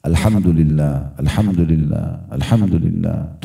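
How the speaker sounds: low, measured, unhurried repetitions; slow, reflective dhikr. Shown as a demonstration. Preacher shows worshippers how to contemplate, slowly. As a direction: let each phrase sink in, slow and low. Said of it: "Resapi, renungi, pelan-pelan".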